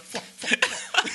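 loud mock stomping noises; imitating buddy's stomp sounds; stomping sound effects. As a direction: bark out each stomp like a buddy. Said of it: "he was just like".

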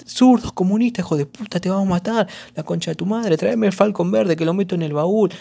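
lightly imitating a hateful heckler; mock hateful liberal. Liberals ranting at leftists, lightly mock hateful. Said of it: "tan criado a base de odio".

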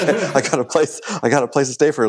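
high, laughing relief. Retold or imitated. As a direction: laugh through the happy news. Relief, laughing while quoting.

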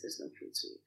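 hushed, near-whispered retold reply to a husband; gently breaking it. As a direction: drop to a soft, patient murmur. Repeats it gently to worried husband.

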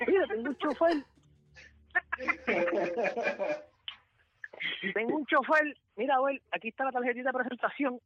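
high, lively voiced boss; other boss voiced. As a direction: pitch him up to hand over the card. Retold boss recommending a driver. (jefe imitado con voz aguda)

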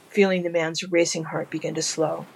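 usual speaking voice

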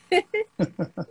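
short bright burst of laughter; laughing. Real, not acted. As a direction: laugh out loud, high. Laughs at fan noise remark.

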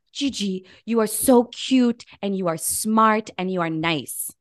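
ordinary talk, nothing marked

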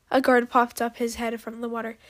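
usual speaking voice

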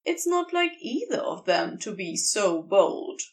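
ordinary delivery, nothing marked

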